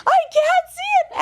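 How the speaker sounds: put-on very high, loud, childish wail; whiny mock protest. Brother insisting to his sister he can't see it, mock wail. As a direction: squeal it like a frustrated kid.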